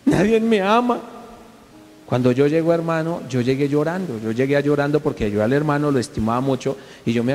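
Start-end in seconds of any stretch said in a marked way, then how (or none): 0.1-1.0 high, slow, hammed-up whimper; mock self-pitying cry. Preacher imagines the injured man crying, high slow mock whimper. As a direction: wail it like a sulking child. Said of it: "estuviera por allá llorando".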